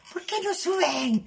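very high, slow mock wail. Teller left behind calling to the others, high mock wail.

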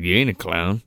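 low, unimpressed character voice; Joe. Retold or imitated. Retelling Joe facing the clown, low dismissive imitation. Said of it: "He's like".

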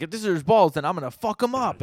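loud, high mock outrage; freaking out. Retelling Cotton kicking the wall, imitated freak-out. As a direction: act out a furious threat. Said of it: "freaking out".

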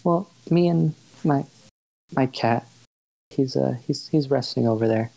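lightly voiced awkward shopkeeper; hesitant shopkeeper Ash.

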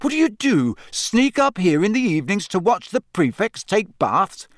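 put-on outraged boy; indignant. A narrator's reading. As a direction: fire it at her in disbelief. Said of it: "said Harry indignantly".

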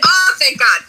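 shouted relief, then a high laugh; relieved laughter. Retold or imitated. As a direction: blurt out thanks and laugh. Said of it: "And I'm like".

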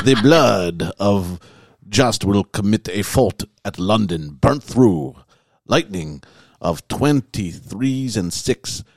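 slow, drawn-out fake French accent read; hammed-up French accent, slow and loud; mock French accent. Reading Nostradamus to friends in a mock French accent. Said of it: "Do it, do it in a French accent".

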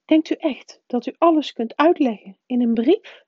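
the strict witch protesting the Dursleys; indignant professor. A narrator's reading. McGonagall voiced by the narrator.

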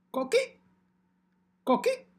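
very high rising frog chirp; coqui frog call. Acted. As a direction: chirp it like a tree frog warming up. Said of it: "is merely my warm-up phrase for my mic check".

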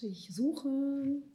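ordinary talk, nothing marked

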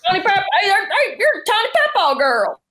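high, eager fan calling out; excited fan. Retold or imitated. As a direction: mimic a fan who just recognized her. Imitating fans spotting her in public.

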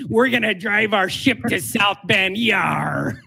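hammed-up pirate growl, ending in a shout. Co-host mocks the bad leprechaun clip as a pirate, hammed-up. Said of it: "sounded more like a pirate".

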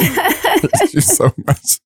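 high laughing thanks. Real, not acted.

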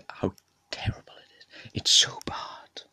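hushed whisper so no one hears. Real, not acted. Hiding his rugby rant from someone in the house, whisper. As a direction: whisper it, someone's just come home. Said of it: "they're gonna hear me shouting".

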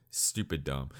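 ordinary delivery

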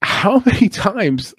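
high and slow, laughing in disbelief; incredulous laugh. Real, not acted. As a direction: laugh through the question in disbelief. Incredulous about debts repaid.

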